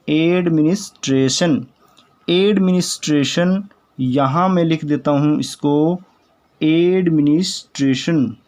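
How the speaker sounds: Administration said slowly and carefully; teacher slows down administration; administration spoken syllable by syllable; slow model pronunciation. Shown as a demonstration. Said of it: "शुद्ध बोलते हैं तो administration".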